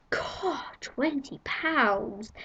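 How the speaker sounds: mock outrage at the cost; mock shock. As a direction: stretch twenty pounds out in disbelief. Shocked at the price.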